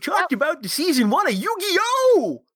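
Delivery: put-on high, hollering old prospector voice. Continues prospector ad read.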